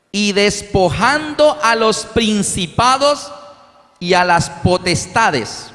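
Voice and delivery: slow, solemn reading of the verse; scripture reading. Acted. Preacher reads Colossians to the congregation, solemn. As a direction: read the Bible verse with gravity.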